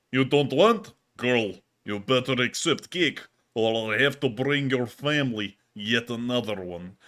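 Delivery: slow, heavy Russian accent, half-hammed; thick Russian accent. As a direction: play a menacing Russian cake enforcer in a thick Russian accent. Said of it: "Say the following with that thick Russian accent".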